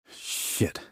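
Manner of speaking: hushed curse, barely voiced; soft whispered swear. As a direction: swear under your breath so nobody hears. Sniper curses under his breath.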